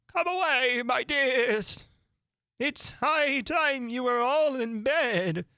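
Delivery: very high, drawn-out anxious call; trembling canary call. A narrator's reading. Canary calls children from danger, high and trembling. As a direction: call the children away in alarm. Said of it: "called out in a trembling voice to its children".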